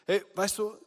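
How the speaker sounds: usual speaking voice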